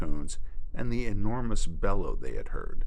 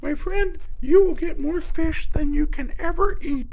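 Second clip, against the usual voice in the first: very high, light bird voice; spoonbill voice; 7 dB louder than usual. Acted. Spoonbill introduces himself to the hurt alligator, birdlike. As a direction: chirp it like a small bird.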